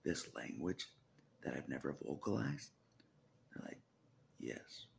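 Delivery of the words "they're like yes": ordinary talk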